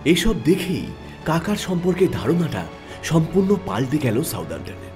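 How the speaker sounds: plain reading voice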